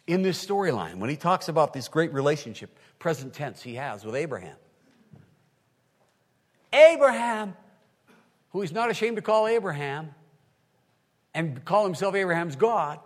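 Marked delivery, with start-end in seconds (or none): none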